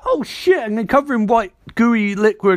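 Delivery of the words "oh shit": sudden high "oh shit" in alarm; startled yelp. Retold or imitated. Barry startled as goo hits him, high yelp. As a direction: yelp it out, pitch shooting up. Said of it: "And Barry's like".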